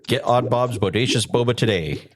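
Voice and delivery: cheesy sponsor announcer; ad read. Acted. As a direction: sell it like a radio spot.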